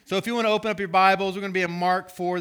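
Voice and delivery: fast, hyped-up rush; too much caffeine. Real, not acted. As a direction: rattle it off, wired. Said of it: "I had, like, four cups of coffee today".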